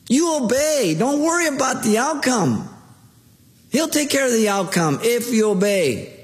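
impassioned preaching. Acted. Pastor illustrating obedience with a child's game, fervent preaching. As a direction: preach it to the pews with rising fervour.

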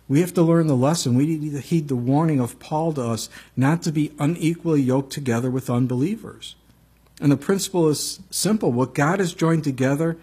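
ordinary talk, nothing marked

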